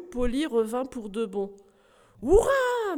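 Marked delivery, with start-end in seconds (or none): 2.2-3.0 high, loud joyful shout; joyful cheer. Acted. Knight cheering as his parrot returns, joyful and loud. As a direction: burst out cheering.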